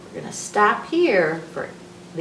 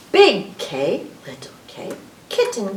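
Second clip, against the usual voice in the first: normal talking voice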